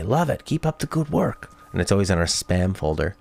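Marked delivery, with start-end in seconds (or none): 0.0-1.5 bot comment voice. Retold or imitated. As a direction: read it like a canned spam bot. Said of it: "They say".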